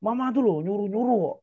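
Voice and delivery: put-on child snapping at mom, half-hearted anger; mock angry child. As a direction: pout and whine back at mom. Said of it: "Terus marah".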